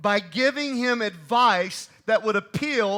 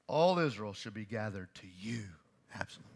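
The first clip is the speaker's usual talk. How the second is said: hushed counsel; 11 semitones lower, 8 dB quieter than usual. Retold or imitated. Preacher whispers Hushai's counsel.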